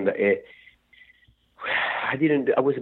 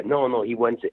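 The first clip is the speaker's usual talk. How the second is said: ordinary delivery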